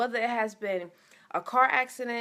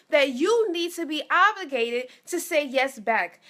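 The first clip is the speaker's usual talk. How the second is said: higher and louder, building fervor; preaching; 3 semitones higher, 4 dB louder than usual. Acted. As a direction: preach it to the congregation, rising. Preaching climax.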